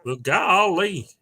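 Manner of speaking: high, drawn-out country bumpkin; Gomer Pyle. Acted. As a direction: stretch it out in a goofy Southern yokel voice. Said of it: "want me to do my Gomer Pyle".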